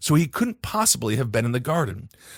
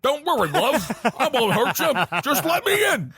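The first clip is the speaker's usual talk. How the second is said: put-on Lancashire accent; 10 semitones higher than usual. Host giving the entity's plea once more, high laughing Lancashire accent.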